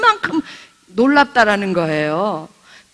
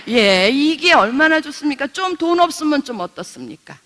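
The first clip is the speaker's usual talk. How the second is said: normal talking voice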